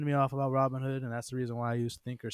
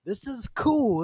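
excited; 11 semitones higher, 7 dB louder, slower than usual. Retold or imitated. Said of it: "it's like".

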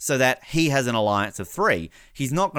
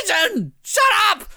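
high, louder shout at the screen; yelling at the TV; 4 dB louder than usual. Retold or imitated. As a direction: scream it like the TV can hear. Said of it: "I was, like, screaming at my T V screen".

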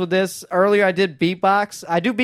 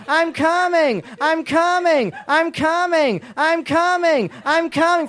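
high, drawn-out repeated moans; partner's endless fake climax, higher and slow; mock orgasm moan; 7 semitones higher, slower than usual. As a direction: ham up a fake orgasm chant. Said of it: "She starts yelling".

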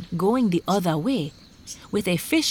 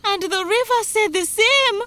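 very high, urgent report; worked-up high-pitched bather; alarmed bather; 3 dB louder than usual. Acted. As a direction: pipe up in alarm. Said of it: "the bather said".

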